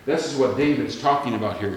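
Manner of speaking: ordinary talk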